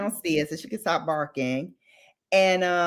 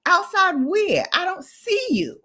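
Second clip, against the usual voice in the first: high-pitched retold incredulous comeback; 10 semitones higher than usual. Arguing by phone with the man outside, incredulous. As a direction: challenge him in a high, mock-exasperated voice. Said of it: "I'm like".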